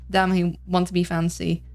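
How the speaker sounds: ordinary talk, nothing marked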